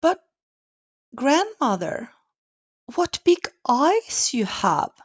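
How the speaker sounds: put-on high child's voice, wondering; a wide-eyed child; little girl voice.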